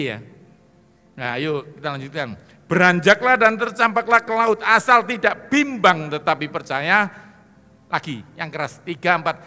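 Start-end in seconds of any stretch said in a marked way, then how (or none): 2.7-9.4 high, loud preaching; loud scripture recitation. Acted. Preacher leading congregation, loud recitation. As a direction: declaim the verse to the crowd.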